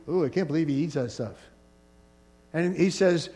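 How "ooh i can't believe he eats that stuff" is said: quick, quiet, scandalized tut of disapproval; judgmental disgust. Acted. Church judge sniffing at the vegetarian, fast and disdainful. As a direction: sniff at him like a church snob. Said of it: "this judgmental attitude".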